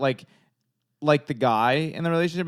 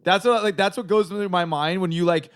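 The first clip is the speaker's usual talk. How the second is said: ordinary talk, nothing marked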